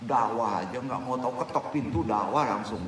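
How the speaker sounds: usual speaking voice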